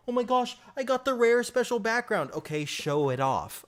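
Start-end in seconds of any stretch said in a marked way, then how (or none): 0.1-2.3 squeaky high bragging gamer voice; mocking voice. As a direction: mimic a smug player, high-pitched. Mocking other players' bragging.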